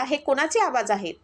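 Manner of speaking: ordinary delivery, nothing marked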